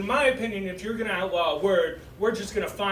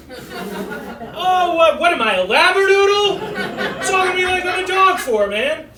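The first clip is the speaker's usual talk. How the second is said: much higher and louder, mock outrage; high, loud indignant yelp; indignant outburst; 12 semitones higher, 10 dB louder, slower than usual. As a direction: squawk it like an offended dog. Indignant retort to compliment.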